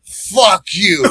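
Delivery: loud, high, angry "Fuck you"; drunk snarl. Retold or imitated. Drunk passenger snarling at the driver, loud and angry. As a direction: spit it at him.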